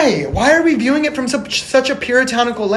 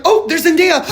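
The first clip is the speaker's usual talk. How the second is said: higher, louder delighted recognition; starstruck surprise; 7 semitones higher, 5 dB louder than usual. Retold or imitated. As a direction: spot a celebrity and light up. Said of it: "It takes me out of the scene 'cause I'm like".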